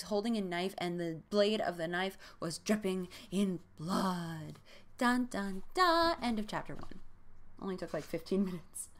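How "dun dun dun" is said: mock cliffhanger sting, last note jumping high; dramatic "dun dun dun". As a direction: sound a hammy end-of-chapter sting. Dramatic sting ending a chapter.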